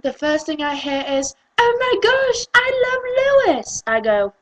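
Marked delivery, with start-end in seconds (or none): 1.6-3.8 high, loud squeal imitating a fan; mock fangirl gushing. Mocking an American fan who loves the band, high gushing. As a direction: gush like an overexcited fangirl.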